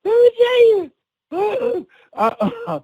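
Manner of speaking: high, giggly attempt to carry on; laughing. Real, not acted. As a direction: start talking before the laughter has settled. Still recovering from laughing fit.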